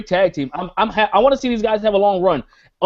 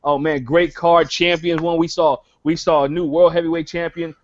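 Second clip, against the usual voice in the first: ordinary talk, nothing marked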